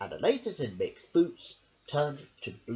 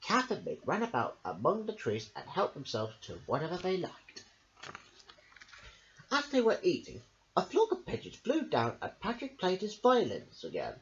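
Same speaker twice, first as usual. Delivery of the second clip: plain reading voice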